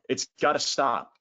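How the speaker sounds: normal talking voice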